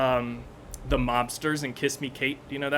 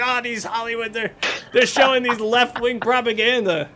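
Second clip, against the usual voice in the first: high, loud mock outrage; mocking pundit; 8 semitones higher, 3 dB louder than usual. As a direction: ham up a ranting pundit. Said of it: "Rush Limbaugh or Sean Hannity was warning against".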